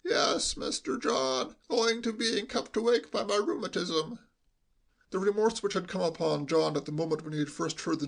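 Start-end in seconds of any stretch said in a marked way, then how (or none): 0.0-4.2 put-on high, bleating voice of a doddering old butler. A narrator's reading. Old butler answering young master John, high and bleating. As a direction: bleat it like a meek old sheep. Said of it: "bleated like a sheep when he talked".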